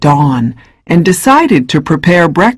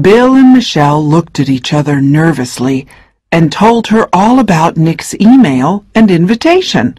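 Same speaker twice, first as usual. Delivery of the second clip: plain reading voice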